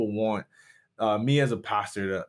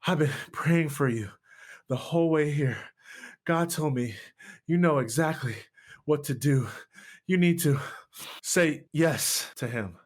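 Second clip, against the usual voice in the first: lightly voiced grandmother, out of breath and urgent; a hint of a praying grandmother pressing him; breathless grandmother; 4 semitones higher than usual. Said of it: "She's breathing heavy".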